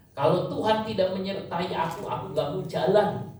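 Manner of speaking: preacher voicing Moses refusing God's command; pleading Moses. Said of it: "Musa waktu Tuhan bilang".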